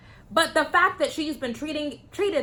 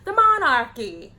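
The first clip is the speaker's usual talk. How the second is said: hint of a bad British accent, louder; 3 semitones higher, 5 dB louder than usual. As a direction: another go at a fake British accent. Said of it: "my British accent".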